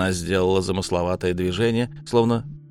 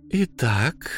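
a purred, rising So; purring; 5 semitones higher than usual. A narrator's reading. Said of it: "промурлыкала сфинкс".

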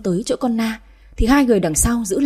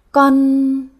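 higher, drawn-out falter; frightened stammer; 4 semitones higher, 3 dB louder than usual. A narrator's reading. Maid under the master's stare, frightened stammer. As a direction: stall fearfully on the word. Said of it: "lắp bắp nói".

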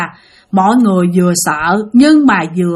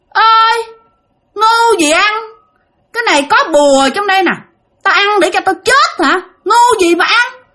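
high, loud indignant refusal; vong cãi bướng; 10 semitones higher, 3 dB louder than usual. Acted. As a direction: scoff back as the defiant spirit. Vong đáp trả thầy, gắt gỏng. (giọng vong cao, cãi bướng)

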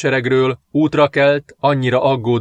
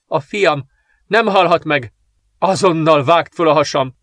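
higher, louder frantic commands; desperate yelling; 4 semitones higher, 3 dB louder than usual. A narrator's reading. Princess ordering the midwife to cut her open, desperate yell. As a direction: order it through anguish. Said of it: "ordította zokogva".

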